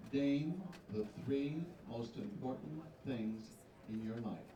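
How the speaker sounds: slow, carefully spaced question; slow and deliberate. Shown as a demonstration. Host reads the pageant question slowly to the contestant. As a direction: spell the question out slowly so she catches it. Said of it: "I'm gonna say it twice for you".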